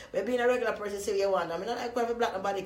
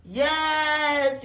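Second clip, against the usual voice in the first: put-on American accent, high and loud; an imitated American fan yelling with joy; excited American voice; 6 semitones higher, 8 dB louder than usual. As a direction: gush like an excited American girl. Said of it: "Her voice, you know ... American".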